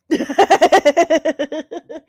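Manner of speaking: a loud, high burst of laughter; delighted laugh. Real, not acted. Laughing at a viewer's joke, loud and high. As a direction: let the laugh break out freely.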